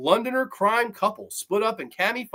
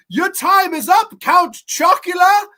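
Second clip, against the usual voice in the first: high, loud, slow taunt; mock British villainous challenge; hammed-up trash talk; 11 semitones higher, 9 dB louder than usual. Zombie woman with a stake taunts the vampire, loud and hammed-up. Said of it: "they start talking shit to each other".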